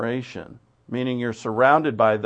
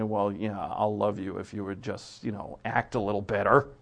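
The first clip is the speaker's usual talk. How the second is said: grudging condition; 4 semitones lower, 6 dB quieter than usual. Retold or imitated. Conditional friend voiced.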